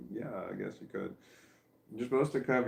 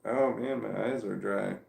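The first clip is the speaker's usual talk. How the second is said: ordinary talk, nothing marked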